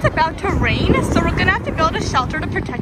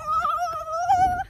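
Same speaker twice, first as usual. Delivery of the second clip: very high, drawn-out yeehaw; cowboy whoop; 11 semitones higher than usual. Pretend. Cowboy call.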